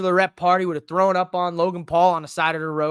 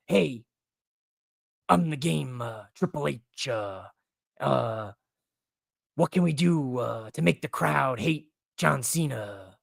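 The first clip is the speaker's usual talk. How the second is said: Triple H impression; 5 semitones lower, 5 dB quieter, slower than usual. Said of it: "greatest impressions ever".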